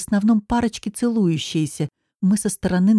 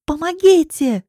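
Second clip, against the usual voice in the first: high, loud, drawn-out cry for help; scream for help; 10 semitones higher, 5 dB louder, slower than usual. Acted. Girl dragged to a car, screaming for help. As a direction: shout for anyone to hear. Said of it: "кричу я".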